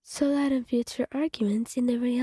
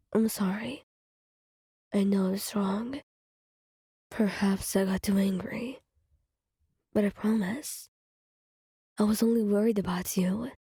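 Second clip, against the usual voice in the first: gentle apologetic Gyu; 2 semitones lower than usual. Acted. Gyu apologizes after yelling.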